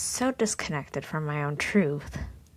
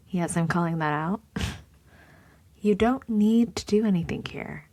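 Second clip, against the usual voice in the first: ordinary talk, nothing marked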